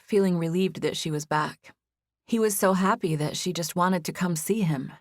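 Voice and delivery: plain reading voice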